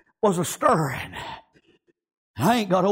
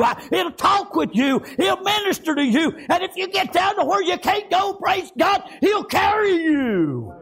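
high, shouted revival cadence; fired-up preacher in full cry; full-force preaching; 9 semitones higher than usual. Real, not acted. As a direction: shout it out in driving, rhythmic phrases.

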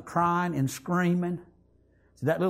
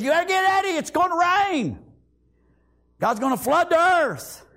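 high, loud urgent warning; Noah warning; 9 semitones higher, 3 dB louder than usual. Acted. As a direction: call out like a prophet warning the people. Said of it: "Noah said".

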